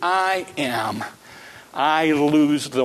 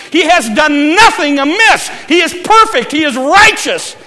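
much higher and louder, shouted proclamation; full-force preaching; 11 semitones higher, 8 dB louder than usual. Acted. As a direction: thunder it from the pulpit.

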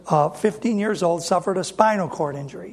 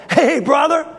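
eager greeting; 8 semitones higher, 3 dB louder than usual. Retold or imitated. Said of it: "and I go".

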